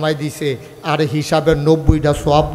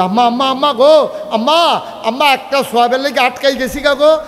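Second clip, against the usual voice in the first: put-on a child begging his mother for one reward; pleading child; 5 semitones higher than usual. Son begging his mother on judgment day, high pleading cry. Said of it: "তুমি কইবে".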